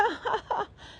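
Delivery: very high mocking cackle; devilish laugh. Sister-in-law mocking the baby, high devilish cackle. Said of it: "Avec son rire de chetana".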